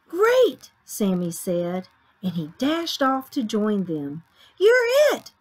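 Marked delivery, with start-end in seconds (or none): 0.1-0.7 high, loud burst of delight; excited kid. Acted. Sammy is keen to join tag, excited. Excited young shark joins game. 4.6-5.3 very high, loud tag call; playful shout. Acted. Shrimp tags a playmate in the playground, shouting. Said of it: "shouted a shrimp".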